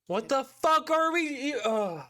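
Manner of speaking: sudden high incredulous yelp; exasperated outburst. Real, not acted. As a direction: blurt it out in disbelief. Streamer reacting to Trump clip.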